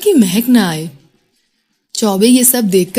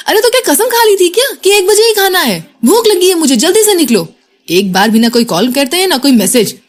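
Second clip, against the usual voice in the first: higher, louder, hungry irritation; angry scolding; 7 semitones higher, 3 dB louder than usual. A narrator's reading. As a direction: nag him to hurry out. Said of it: "और गुस्से से call काट दी उसने". (गुस्से में ऊँची आवाज़ में डाँटना)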